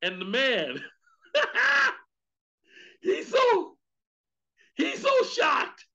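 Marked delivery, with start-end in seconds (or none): 1.3-5.8 laughing out the words, very high; cracking up, pitched far up. Real, not acted. Preacher laughing as he tells the church, very high.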